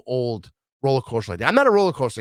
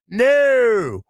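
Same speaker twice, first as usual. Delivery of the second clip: higher, louder shouted no; emphatic no; 7 semitones higher, 6 dB louder than usual. Real, not acted. Shouting no to people asking about LA. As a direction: shout the no right back.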